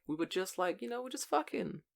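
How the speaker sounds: high, soft whiny excuse; friend's high-pitched excuse about just hanging out. Retold or imitated. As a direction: whine it out like a guy dodging blame. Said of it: "'Cause he's like".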